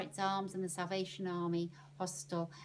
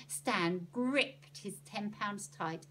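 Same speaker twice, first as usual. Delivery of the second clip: ordinary delivery, nothing marked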